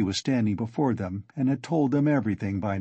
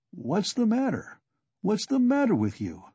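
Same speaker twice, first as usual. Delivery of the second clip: worried, a little higher questioning; anxious; 9 semitones higher than usual. A narrator's reading. Friend anxiously asking a dizzy Raskolnikov, mildly worried. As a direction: ask with mild alarm in the voice. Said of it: "Razumian asked anxiously".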